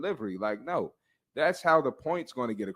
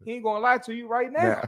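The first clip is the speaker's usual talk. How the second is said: much higher mock TV doctor; hammed-up catchphrase impression, pitched way up; Doctor Now; 10 semitones higher than usual. Explaining the doctor to his co-host, mock doctor impression. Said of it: "deliver like Doctor Now".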